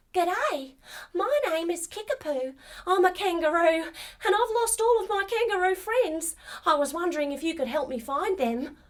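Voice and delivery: a hint of a chirpy young kangaroo; lightly voiced kangaroo. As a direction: speak like a friendly little kangaroo. Kangaroo character speaks.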